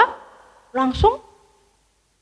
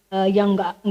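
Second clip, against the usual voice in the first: plain reading voice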